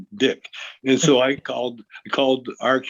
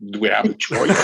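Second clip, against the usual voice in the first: laughing retort; 4 semitones higher, 4 dB louder than usual. Retold or imitated.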